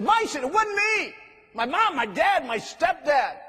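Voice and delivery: preacher voicing a teen who lost it at him; imitated furious outburst. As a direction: fling the blame out in a loud, high cry. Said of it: "she lost it".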